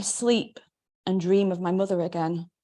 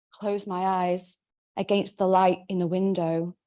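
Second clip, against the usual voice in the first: plain reading voice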